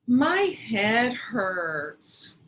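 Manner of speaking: hammed-up moaning complaint.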